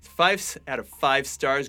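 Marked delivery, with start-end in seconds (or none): none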